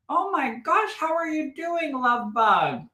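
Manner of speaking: high-pitched fond greeting; gushing affection. Real, not acted. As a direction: coo excitedly at a dear friend. Greeting someone long unseen.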